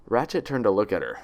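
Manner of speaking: plain reading voice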